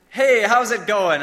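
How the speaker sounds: big, loud, drawn-out mock hello; hammed-up greeting. Camp worker hiding a forgotten name, overdone friendliness. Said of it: "really good at avoiding the use of names".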